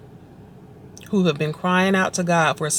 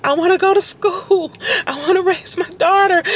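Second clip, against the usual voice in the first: much higher, choked-up crying plea; tearful pleading prayer; 12 semitones higher than usual. Retold or imitated. Retelling her prayer in the closet, crying high and pleading. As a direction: beg God through tears in a high voice. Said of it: "And I was like".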